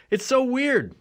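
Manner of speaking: normal talking voice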